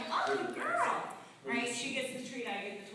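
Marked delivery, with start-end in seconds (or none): 0.1-1.0 much higher happy praise; dog praise voice. Real, not acted. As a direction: coo at the dog.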